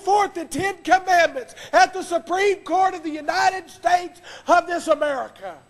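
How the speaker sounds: high, loud pulpit cry; full-force preaching. Real, not acted. Preacher decrying the Supreme Court over the Ten Commandments, full-force preaching. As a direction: thunder it out to the congregation.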